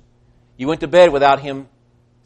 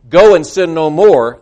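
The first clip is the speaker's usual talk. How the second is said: louder, slow and firm; slow, louder command to sin no more; firm truth; 3 semitones higher, 8 dB louder than usual. Shown as a demonstration. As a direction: lay it down as a command. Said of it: "that's truth".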